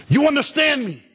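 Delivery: sudden high-pitched yelled demand; mock dad yell. Dad confronting his son, mock angry yell. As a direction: burst out like a comically angry father. Dad's retort after tender build-up.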